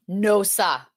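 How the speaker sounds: "sah" with no R, like "sah"; Boston "No, sah". Shown as a demonstration. Teacher demoing a local phrase, Boston accent. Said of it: "It's a local way of saying".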